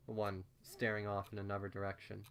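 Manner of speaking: ordinary delivery, nothing marked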